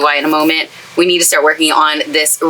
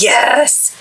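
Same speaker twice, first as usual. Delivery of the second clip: higher-pitched, drawn-out cheer; retold delighted yes; 6 semitones higher than usual. Crafter recalling finding her favorite donuts, delighted. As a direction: celebrate it with a lifted voice.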